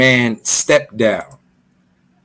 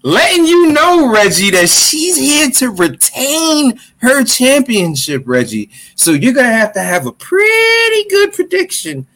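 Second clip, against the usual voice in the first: announcer hype; 10 semitones higher than usual. Acted.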